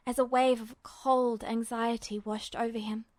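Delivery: usual speaking voice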